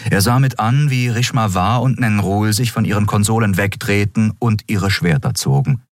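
ordinary delivery, nothing marked

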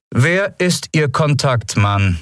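high, slow, pressing questions; cold interrogation. A narrator's reading. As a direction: drill each word into him. Interrogation resumes.

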